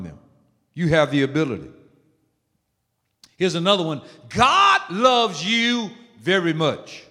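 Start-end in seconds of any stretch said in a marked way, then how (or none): 4.3-7.0 slow, higher start on "God"; emphatic affirmation. Shown as a demonstration. Preacher showing how to encourage others, slow and emphatic. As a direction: stretch out the opening words, then fall.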